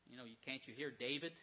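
usual speaking voice

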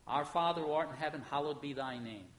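soft, reverent recitation; prayer recitation. Acted. Preacher reciting the Lord's Prayer to the church, softly. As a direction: pray it quietly.

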